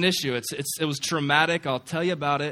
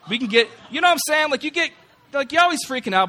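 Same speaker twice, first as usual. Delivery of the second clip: much higher and louder, laughing through it; excited; 13 semitones higher, 10 dB louder, faster than usual. Real, not acted. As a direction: spill it out fast, worked up and amused. Excited joking about stressed students.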